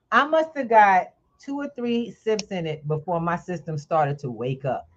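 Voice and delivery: ordinary delivery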